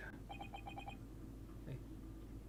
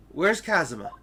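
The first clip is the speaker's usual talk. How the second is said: urgent demand; 6 semitones higher, 7 dB louder than usual. Acted. Demanding friend's whereabouts.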